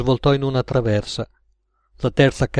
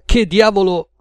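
higher, louder outburst; pitched-up, blustering protest; indignant exclamation; 7 semitones higher, 5 dB louder than usual. A narrator's reading. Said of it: "esclamò".

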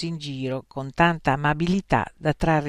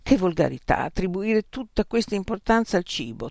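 plain reading voice

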